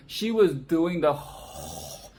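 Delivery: usual speaking voice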